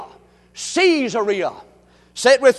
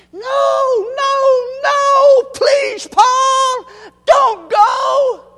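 high, loud, crying plea; pleading friends; 12 semitones higher, 5 dB louder, much slower than usual. Acted. Friends crying out, begging Paul not to go to Jerusalem. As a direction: beg him desperately not to leave.

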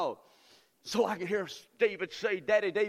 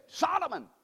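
father David proclaiming; 9 dB louder, slower than usual. Retold or imitated. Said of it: "David says".

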